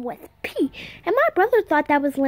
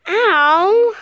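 short pained yelp, louder; sharp ow; 5 semitones higher, 6 dB louder than usual. Real, not acted. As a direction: yelp at a sudden sting. Yelps in pain.

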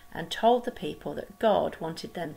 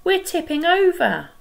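desperate cry; 11 semitones higher, 9 dB louder than usual. Acted. Second sailor pleading in the storm, desperate cry. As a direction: beg heaven at the top of the voice. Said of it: "cried another".

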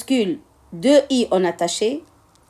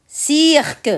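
"Cirque" stretched into cir-que, higher pitched; syllable by syllable; 4 semitones higher than usual. Shown as a demonstration. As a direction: say "Cirque" drawn out, sounding the final que. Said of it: "Je découpe les mots de la fiche en syllabes". (« Cirque » découpé en syllabes, cir-que)

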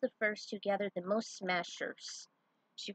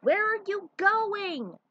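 called back; 12 semitones higher, 7 dB louder, slower than usual. Acted. Fox calls back over engines.

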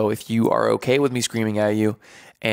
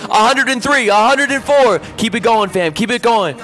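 boot camp hype; 10 semitones higher, 5 dB louder than usual. Pretend.

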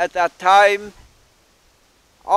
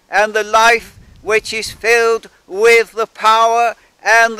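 prayer swelling into louder preaching; fervent preaching; 3 semitones higher, 4 dB louder than usual. Acted. Minister praying for holy fire, rising fervour. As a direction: build the fire of the sermon, pressing each phrase.

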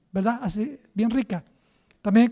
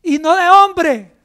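slow, drawn-out acclaim, higher and louder; the people hailing Herodes as a god, loud slow acclaim; crowd acclaiming; 6 semitones higher, 9 dB louder, much slower than usual. A narrator's reading. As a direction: cry it out like a crowd hailing a king. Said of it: "el pueblo aclamaba gritando".

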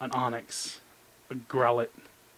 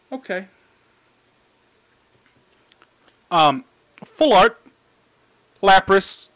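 excited; 5 semitones higher, 12 dB louder, slower than usual. Real, not acted. Pulls a Full Art Lapras.

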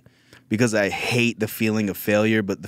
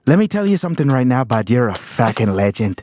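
put-on loud, higher drunk Irish fan in an Irish accent; comic imitating a drunk Irish fan cheering him on, Irish accent; 4 semitones higher, 5 dB louder than usual. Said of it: "an Irish traveler ... he goes".

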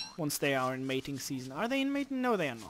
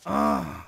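deep loud groan of frustration; angry groan; 6 dB louder than usual. Real, not acted. Gamer groaning after losing his crop.

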